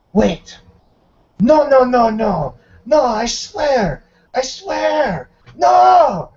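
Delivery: high, loud panicked begging; desperate pleading. Acted. Dex pleads with the guards before the shot, high panicked cries. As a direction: Dex begs for his life, rising to a cry.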